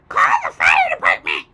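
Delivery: put-on high, loud shrieking old crone; shout. A narrator's reading. Grandma with burning stomach shrieks for help, high and loud. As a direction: scream for help like an old hag. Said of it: "she shouted suddenly".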